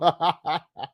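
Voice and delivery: a quick high chuckle; short laugh. Real, not acted. Still amused by the clip, short laugh.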